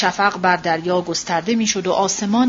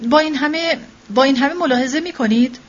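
higher-pitched, mildly delighted question; happily; 7 semitones higher than usual. A narrator's reading. Mother to the teacher about the child's playing, mildly happy. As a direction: lift the pitch with understated pleasure. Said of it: "با خوشحالی گفت".

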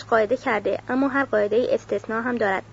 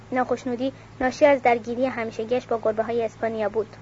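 ordinary delivery, nothing marked

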